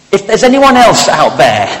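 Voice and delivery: high, loud frantic call; frantic plea. Acted. Man refuses God's answer and shouts for anyone else, high and frantic. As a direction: cry out again, hoping for another answer. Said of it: "in frantic desperation".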